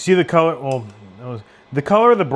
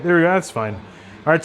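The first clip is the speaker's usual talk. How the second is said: ordinary talk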